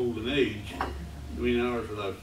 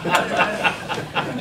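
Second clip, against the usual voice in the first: a short real laugh, loud and a little higher; rueful laugh; 5 semitones higher, 7 dB louder than usual. Real, not acted. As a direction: laugh off his own grumbling. Laughs after complaining about events.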